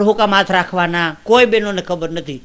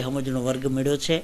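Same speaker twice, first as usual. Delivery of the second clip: ordinary talk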